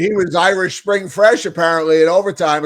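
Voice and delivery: usual speaking voice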